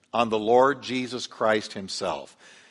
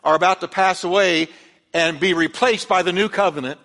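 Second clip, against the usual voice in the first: fervent preaching; 7 semitones higher, 4 dB louder than usual. Acted. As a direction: lift the church up with it. Preaching at full force.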